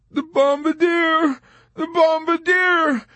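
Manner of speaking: high, loud, drawn-out wail; frantic cry. A narrator's reading. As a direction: cry out slowly and desperately. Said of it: "Dobbs cried".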